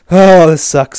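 fed up with another rejection; frustrated outburst. Real, not acted. Trade rejected again.

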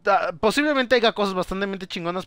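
ordinary delivery

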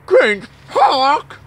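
high, loud disbelieving cry; hammed-up outrage; loud incredulous. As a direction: shout it back in mock shock. Second take, loud high incredulous.

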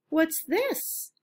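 put-on curious teenager. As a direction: lift up into a curious teen's question.

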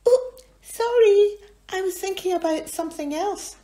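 very high, loud flustered apology; high flustered bird voice; apologetic Jeannie. Acted. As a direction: say sorry in a fluster. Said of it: "Jeannie apologised".

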